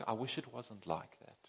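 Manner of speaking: soft, shrugging sigh of a wish; half-hearted wish. Retold or imitated. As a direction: say it like it barely matters. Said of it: "Not just like".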